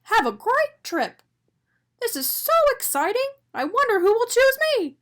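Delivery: high, bubbling excitement; thrilled little donut voice; excited donut. A narrator's reading. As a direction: gush with eager joy. Arnie excited at bakery.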